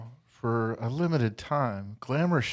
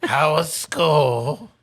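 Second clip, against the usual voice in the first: put-on slow, louder grandma voice checking on the kids; gruff grandma; 6 dB louder, slower than usual. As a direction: ask it slowly and firmly like a tough grandma. Grandma greets Kip after school.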